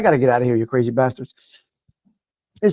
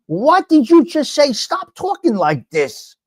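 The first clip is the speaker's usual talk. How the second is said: high, drawn-out exasperated reproach; exasperated scolding; 9 semitones higher, slower than usual. Real, not acted. Telling off someone offering hollow help, exasperated scolding. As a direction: scold them slowly, starting high.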